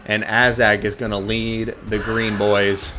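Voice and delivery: energetic esports caster voice; live play-by-play. Acted. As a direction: call the battle like a live caster.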